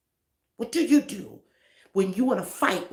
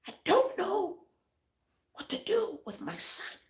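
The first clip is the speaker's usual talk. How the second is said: imitating a father's voice breaking, pitched higher; cracking voice; 12 semitones higher, 3 dB quieter than usual. Pastor retelling a crying father's call, mock cracking plea. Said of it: "you could hear the crackling in his voice".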